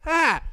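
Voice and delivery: high, loud fed-up groan; exasperated grunt. Retold or imitated. Bus driver fed up with passengers, loud high groan. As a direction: grunt in disgust.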